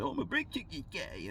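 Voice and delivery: high-pitched mock-driver quote. Mocking an imagined brake-checking driver.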